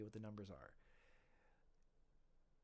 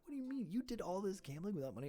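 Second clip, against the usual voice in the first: incredulous guard; 11 semitones higher, 5 dB louder, faster than usual. Acted. Guards confront Lamy over debt.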